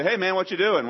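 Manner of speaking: casual, friendly hello to a buddy; friendly greeting. Shown as a demonstration. Greeting a friend he runs into, friendly and casual. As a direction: greet an old friend easily. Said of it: "If I see a friend and say".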